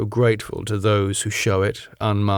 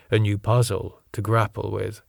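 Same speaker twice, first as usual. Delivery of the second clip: ordinary delivery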